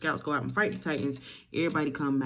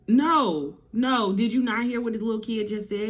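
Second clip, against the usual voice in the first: loud, higher-pitched motherly protest; acted objection; 5 semitones higher, 4 dB louder than usual. Mama objecting to dad's promise, loud acted protest. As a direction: cut in with a forceful mock-mom no.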